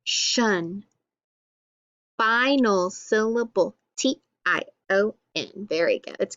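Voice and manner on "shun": teacher giving the TION sound to the class; sound shun. Shown as a demonstration. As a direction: say the ending "Shun" clearly. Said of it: "So we'll say lotion".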